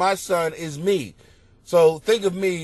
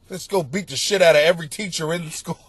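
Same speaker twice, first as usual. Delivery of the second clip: usual speaking voice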